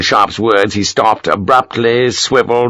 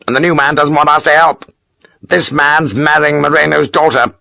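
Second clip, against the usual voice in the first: higher, indignant officer voice; aggrieved Major; 5 semitones higher than usual. Acted. Major grumbling to Sharp about the rival leader. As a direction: complain about the new man.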